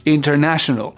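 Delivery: International stressed on NA; inter-NA-tional. Shown as a demonstration. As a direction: push the NA of International. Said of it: "c'est là qu'il va falloir que je fasse mon effort".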